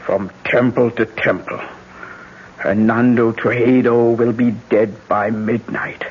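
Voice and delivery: ordinary talk, nothing marked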